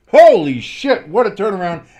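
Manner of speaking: loud, high burst of amazement; amazed exclamation. Real, not acted. Announcer reacting to a sudden turnaround, amazed exclamation. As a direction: burst out in astonishment.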